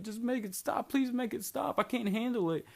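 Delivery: high, strained pleading; a voice climbing high in panic, begging; desperate plea. Retold or imitated. Friend begging the others to make it stop, high desperate plea.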